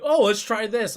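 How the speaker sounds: mock-chirpy impression of a teammate. Mimics teammate suggesting another way.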